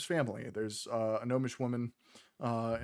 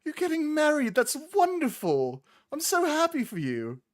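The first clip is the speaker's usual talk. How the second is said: much higher and louder, gushing with joy; delighted gnome woman; 6 dB louder than usual. Acted. Gnome woman congratulating Dio on his wedding, high and gushing. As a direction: squeal with happiness for him.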